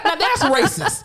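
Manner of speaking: ordinary talk, nothing marked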